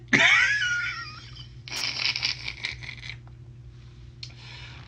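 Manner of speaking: howling high-pitched laugh. Real, not acted. Laughing about the fart.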